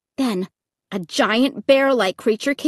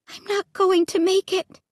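dawning fear; 6 semitones higher than usual. A narrator's reading. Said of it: "I realized".

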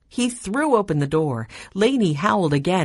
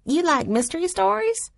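put-on girl voice; 13 semitones higher than usual. A narrator's reading. Lainey, a girl, asks.

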